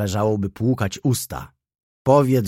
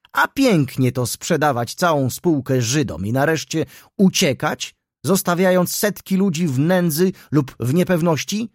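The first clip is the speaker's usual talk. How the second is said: indignant outburst; 5 semitones higher, 3 dB louder than usual. A narrator's reading. Councillor's indignant tirade.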